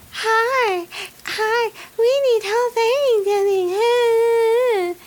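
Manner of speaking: high, drawn-out mock customer voice; imitated customers. Customers coming into the Dollar General, high and halting. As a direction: draw each word out slowly, pitched high.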